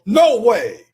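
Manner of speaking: loud, high no way; disbelieving analyst yelling off-mic; incredulous shout. Retold or imitated. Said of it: "Billick kind of in the background going".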